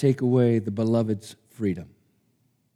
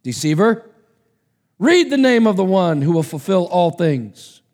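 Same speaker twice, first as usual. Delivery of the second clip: angel's command, louder and higher; 6 semitones higher, 5 dB louder than usual. Acted. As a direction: order him to read.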